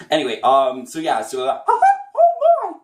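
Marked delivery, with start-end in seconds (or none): 1.7-2.8 much higher, loud squeaky laugh; high falsetto chuckle; Mickey Mouse laugh. Shown as a demonstration. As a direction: giggle like the cartoon mouse. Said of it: "Mickey Mouse".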